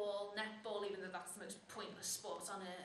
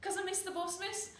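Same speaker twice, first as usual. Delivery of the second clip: high, raised schoolgirl reply; pitched up, calling the excuse to teacher; higher, louder pupil voice; pupil answering teacher; 7 semitones higher, 5 dB louder than usual. Acted.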